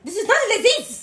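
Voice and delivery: badly faked French accent, half laughing. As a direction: perform a comic French accent on request. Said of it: "Do it again".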